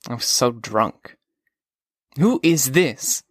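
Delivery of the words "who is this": put-on cold old lady. A narrator's reading. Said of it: "this very cold lady's voice said".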